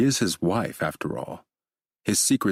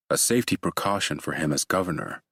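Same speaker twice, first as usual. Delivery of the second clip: ordinary delivery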